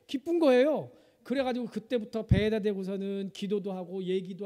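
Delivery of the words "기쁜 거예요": chuckling, a touch slower; laughing joy. Real, not acted. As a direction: laugh through the words. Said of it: "아들이라고 하니까요".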